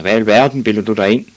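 loud, quick scolding; indignant shout. A narrator's reading. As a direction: shout fast at an intruder. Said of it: "råbte en mand".

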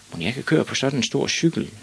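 high, lightly voiced little boy; wondering boy. A narrator's reading. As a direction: wonder to oneself as a small boy.

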